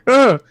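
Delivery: high, loud startled yelp; startled bard's grunt. Acted. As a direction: yelp as if jolted mid-song. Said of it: "hits the wrong chord. And he's like".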